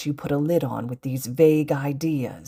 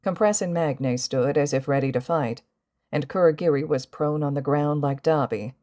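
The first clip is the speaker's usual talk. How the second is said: ordinary delivery, nothing marked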